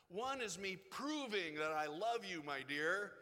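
loud, showy declaration of love; mock proud declaration. Preacher acting a showy husband, loud proud declaration. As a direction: announce your love loudly to prove it.

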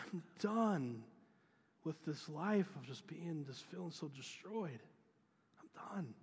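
retelling a friend's tearful confession; tearful plea. Retold or imitated. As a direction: voice straining, close to tears, loud at the start then falling back. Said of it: "and he is bawling".

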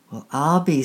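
surprised. Acted. Man's surprised exclamation, story.

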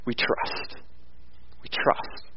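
near-whisper, barely voiced; hushed trust. Shown as a demonstration. Preacher admitting how little we trust when it happens, hushed. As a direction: let it drop to a hushed, reverent breath.

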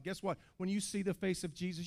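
normal talking voice

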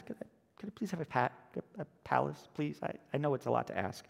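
soft, faltering, apologetic plea; timid request. Acted. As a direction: ask meekly, stumbling over it. Timid fisherman asks for palace.

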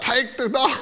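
high-pitched imitation of yelling parents; mock parental yell. Parents to their daughter about the quarter trade, mock yell. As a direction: call it out high, without real volume. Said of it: "the parents yelled out".

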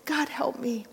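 the ghost whispering the plea as the voice gives out; whispered whimper. Acted. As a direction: let the prayer fade to a whisper. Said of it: "but ended whimpering".

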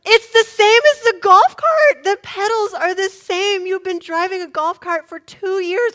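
loud, high-pitched outburst; exasperated panic. Acted. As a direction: blurt it out at full volume, incredulous. Mother panics at daughter asking how.

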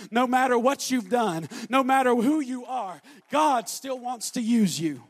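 loud, driving sermon climax; full-force preaching. Acted. Preacher shouts assurance of power to the congregation. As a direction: pound it home to the congregation.

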